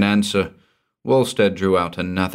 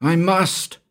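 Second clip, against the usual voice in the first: much higher, desperate cry; 12 semitones higher, 4 dB louder than usual. Acted. Cornered man defying the villain, desperate cry. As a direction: cry it out in despair. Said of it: "cried Walstead".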